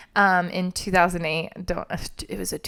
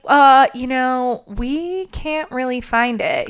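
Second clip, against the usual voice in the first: mock hesitant worry; 5 semitones higher, 4 dB louder, much slower than usual. Faking worry about missing car.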